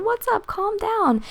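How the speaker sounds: high, soft soothing coo. Retold or imitated. Calming a raging man.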